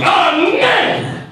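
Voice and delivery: stretched-out high shout, slow and drawn; mock bellow. Intruder shouting at the coffee shop owner, drawn-out mock bellow. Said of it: "Top of his lungs".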